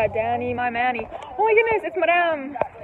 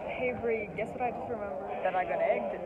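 softly confiding aside; quiet; 5 semitones lower, 11 dB quieter than usual. Real, not acted. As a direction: say it low and quiet.

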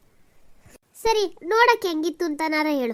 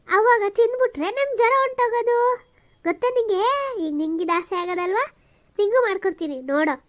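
put-on higher, brighter coaxing to cheer a sick child; 5 semitones higher, 4 dB louder than usual. Grandmother cheering up her feverish granddaughter, coaxing. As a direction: sell the fever cure with excitement.

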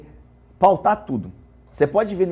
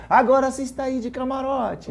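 higher, teasing call-out; playful higher-pitched tease; playful retold jibe; 6 semitones higher than usual. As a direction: quote your own joke, pitched up. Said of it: "Tirei uma brincadeira".

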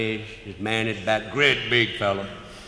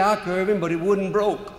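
sheepish excuse; 7 semitones higher than usual. Retold or imitated.

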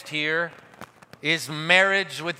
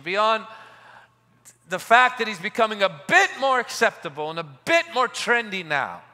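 raised, ringing pulpit voice; fervent preaching; 5 semitones higher, 4 dB louder than usual. Acted. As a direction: preach it out to the crowd. Preaching at full force.

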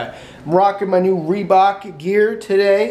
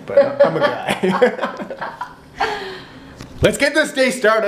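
laughing; 9 semitones higher, much slower than usual. Real, not acted. Laughing through joke.

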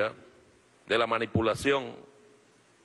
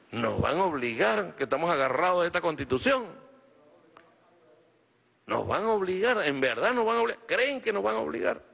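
rising higher and higher with outrage; building rally rant; 7 semitones higher than usual. Real, not acted. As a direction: climb from steady to a high indignant cry.

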